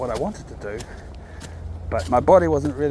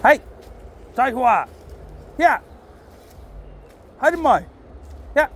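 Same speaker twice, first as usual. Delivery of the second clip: calling the dog; 8 semitones higher than usual. Real, not acted. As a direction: call him in sharply. Calling his dog back.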